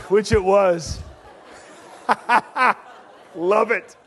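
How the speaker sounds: loud, high, laughing preacher voice; preaching at full force. Real, not acted. Preacher joking loudly to the congregation when bulbs pop. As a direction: boom it out over the crowd.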